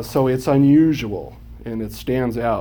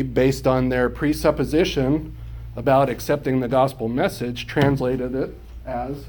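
ordinary delivery

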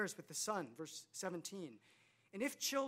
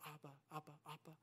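much softer, lightly voiced infant call; baby crying out; 5 semitones lower, 14 dB quieter, much faster than usual. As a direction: murmur it like a reaching baby. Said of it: "cry out".

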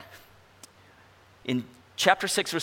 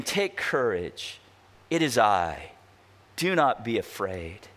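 calm reassurance; 7 semitones lower than usual. Retold or imitated. Jesus speaks calmly in storm.

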